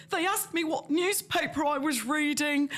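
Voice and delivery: high, slow, drawn-out mock complaint; put-on high voice, stretching the words; mimicked fretful candidate. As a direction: imitate an overwrought interviewee. Candidates coming out of interview, mimicked.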